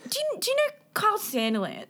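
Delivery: high, preachy mock barista voice. Retelling the barista who lectured them, in a high mocking voice. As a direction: parody the know-it-all barista, pitched well up. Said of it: "he's literally just like".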